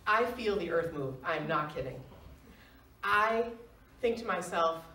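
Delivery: usual speaking voice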